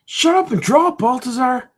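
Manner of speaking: high, loud cartoon yell; critic yelling. The critic character yells at Baltazar, high-pitched shout. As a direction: snap at him in a high shouting voice. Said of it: "he has to yell at me all the time".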